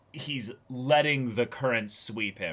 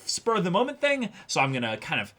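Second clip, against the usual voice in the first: much higher, lightly put-on cautious voice; voicing Mafuyu; 8 semitones higher, faster than usual. Host voicing Mafuyu's careful thoughts, higher put-on voice. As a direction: pitch up into a careful, tentative Mafuyu. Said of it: "Mafuyu has to be careful and be like".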